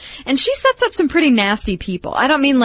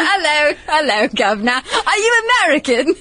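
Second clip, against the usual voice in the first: hammed-up British accent, pitched high; cheesy British accent, "hello, governor"; mock British accent; 6 semitones higher than usual. Doing the English waitress for her cohost in a mock British accent. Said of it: "See, there you go".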